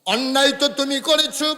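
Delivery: loud, high chanted delivery; full-force preaching. Acted. Prophet reasoning with the woman, loud preaching chant.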